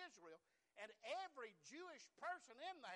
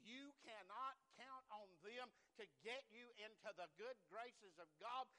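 usual speaking voice

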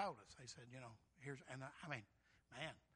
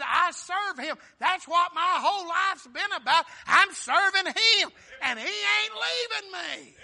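high, loud, stretched-out preaching with shouts; preaching at full force; 11 semitones higher, 20 dB louder than usual. Acted. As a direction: preach it out to the whole church, climbing higher.

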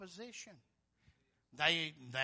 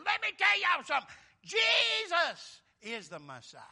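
bold preaching shout; 11 semitones higher, 11 dB louder than usual. Acted. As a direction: thunder it out like Paul in the synagogue.

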